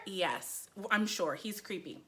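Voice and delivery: softly insistent, sinking in volume; hushed, certain reply; retold quiet confirmation. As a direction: imitate her answering low and soft. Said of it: "people are like, "Are you sure?".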